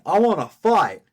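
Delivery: hammed-up Goku craving a fight. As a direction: belt it like an eager Saiyan. Quoting Goku again.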